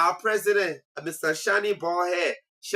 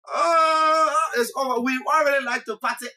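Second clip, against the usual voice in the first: excited shout; 8 semitones higher than usual. Pretend. Imagining Liberia reaching the World Cup.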